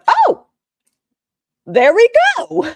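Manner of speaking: loud, high burst of relief; excited shout. Real, not acted. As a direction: cheer it out loud. Tech finally works.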